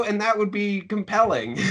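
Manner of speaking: ordinary talk, nothing marked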